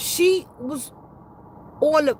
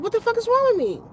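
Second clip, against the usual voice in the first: alarmed outburst; 9 semitones higher than usual. Retold or imitated. Heart hurting after the call.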